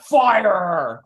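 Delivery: loud, blunt fire her; loud mock verdict, dismissive; mock harsh order. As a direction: bark the order like a know-it-all boss. Mocking listener's harsh verdict.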